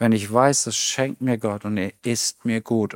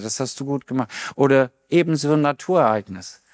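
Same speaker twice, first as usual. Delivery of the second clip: ordinary delivery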